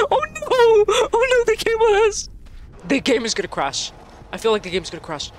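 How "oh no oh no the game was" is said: high-pitched wail of dismay; alarmed. Real, not acted. Player fearing the game will crash, high alarmed wail. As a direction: cry out in high panic.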